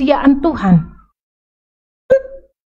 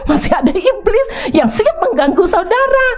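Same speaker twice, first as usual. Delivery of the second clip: high, spooked mock voice; mock fearful; 7 semitones higher than usual. The preacher mocking worshippers who still fear demons, spooked and high-pitched. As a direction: pretend to be scared of demons. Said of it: "Saudara masih mempercaya".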